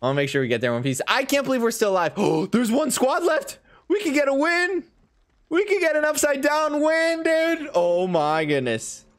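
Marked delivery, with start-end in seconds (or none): 1.1-7.7 high, loud, breathless excitement; thrilled. Real, not acted. Last squad, near win. 7.7-9.0 slow, drawn-out amazement; awed disbelief. Real, not acted. Near victory awe.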